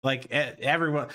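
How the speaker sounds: ordinary delivery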